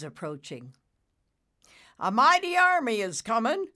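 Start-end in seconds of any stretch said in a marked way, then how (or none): 2.0-3.7 high, loud heralding call; loud announcement. Acted. Bullicus sighting the thunderheads, loud announcement. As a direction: announce it with gusto. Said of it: "Bullicus announced with a snort".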